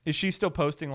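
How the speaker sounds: ordinary delivery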